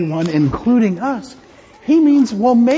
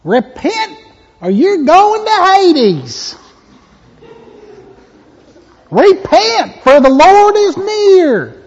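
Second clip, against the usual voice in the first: loud, high southern hellfire preacher, slowed; hammed-up southern accent warning of Hades; mock southern preacher; 9 semitones higher, 7 dB louder, slower than usual. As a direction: thunder it like a southern revival preacher. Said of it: "It was always in a southern accent".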